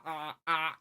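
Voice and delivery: imitated open-mouthed "ah"; wordless noises as if the mouth is full; mouth held open. Patient in the dentist's chair, imitating open-mouthed noises. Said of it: "got his fist in your mouth".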